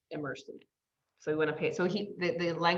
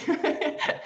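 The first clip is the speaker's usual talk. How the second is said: high laugh; 11 semitones higher, 4 dB louder than usual. Real, not acted. Laughing at herself.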